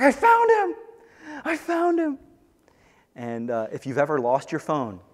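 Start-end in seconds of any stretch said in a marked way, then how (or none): 0.0-2.2 much higher and louder, shrieking with joy; overjoyed cry. Acted. Shepherd finding his lost sheep, overjoyed shouting. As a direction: cry it out like the lost thing is back. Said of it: "rejoices greatly".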